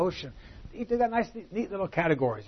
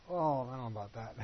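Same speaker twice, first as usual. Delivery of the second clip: quick, hesitant brush-off, softer; reluctant dodge; 3 semitones lower, 7 dB quieter, faster than usual. Pretend. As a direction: back away from the request with a nervous hedge. Reluctant reply to a request for help.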